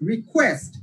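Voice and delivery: light রি, strong falling Quest in request; second-syllable stress. Shown as a demonstration. Teacher repeating for students, second syllable of request stressed. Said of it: "second যে সিলেবলটি আছে ওখানে pressure যাবে".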